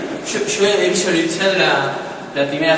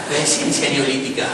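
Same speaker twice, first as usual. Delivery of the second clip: ordinary delivery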